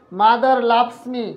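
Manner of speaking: very slow, each word apart; slow dictation. Shown as a demonstration. Teacher reading the English example to students, very slow. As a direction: dictate it slowly for notes. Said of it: "আর একটু ধীরে ধীরে বলবো".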